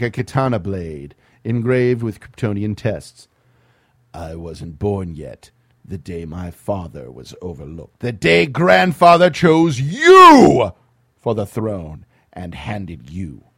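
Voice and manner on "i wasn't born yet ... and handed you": calm controlled villain. Acted. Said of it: "calm, controlled".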